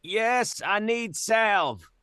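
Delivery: high, drawn-out holler; calling back. Acted. Player as fallen Jens answers the check, calling out. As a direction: call up for help from far off.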